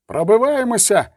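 high-pitched rallying call; young rider's cry. A narrator's reading. Young horseman urging comrades to break through, crying out. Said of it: "крикнув один з молодих кіннотників".

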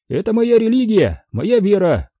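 high, declaiming character line; quartermaster's voice. A narrator's reading. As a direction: proclaim it high, like a creed. Интендант провозглашает своё кредо. (интендант провозглашает, высоко)

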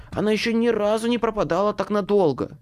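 man fretting, a hint of a hiss; hissed retort. A narrator's reading. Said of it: "шипел мужской голос".

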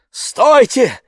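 high, loud halt; urgent call. Acted. Village elder halts the searchers, calling out. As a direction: call out sharply to stop everyone.

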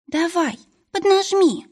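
high, urging little creature voice; encouraging. Acted. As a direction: cheer her on, pitched high. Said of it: "подбадривал Пескун".

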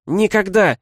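high, loud defiant yell; shout from the crowd. A narrator's reading. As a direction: hurl it from the crowd. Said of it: "Крик из толпы".

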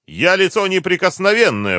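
high, loud protest; indignant cry. A narrator's reading. Captive driver claims immunity to the colonel, crying out. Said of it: "выкрикнул Юрлов".

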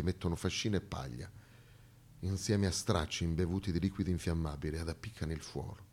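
voce bassa e sommessa, racconto grave; narrazione cupa. A narrator's reading. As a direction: racconta l'orrore piano, con lunghe pause. Racconto del massacro notturno. (narratore che rievoca l'incendio notturno, sommesso e grave)

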